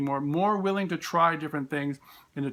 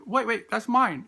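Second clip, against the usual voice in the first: mock protesting child; 7 semitones higher, 3 dB louder than usual. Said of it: "go like".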